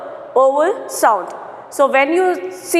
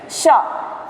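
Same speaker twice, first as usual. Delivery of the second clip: ordinary delivery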